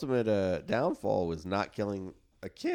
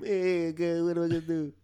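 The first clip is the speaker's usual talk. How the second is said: mild mocking whine; 7 semitones higher, 3 dB louder than usual. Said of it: "when he's fucking mocking him".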